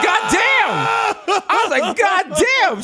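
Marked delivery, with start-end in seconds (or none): 0.1-0.9 high shocked God damn. Retold or imitated. Boy reacting to his mother's harsh words, shocked high exclaim. 2.0-2.8 high shocked God damn. Retold or imitated. Boy reacting to his mother's harsh words, shocked high exclaim.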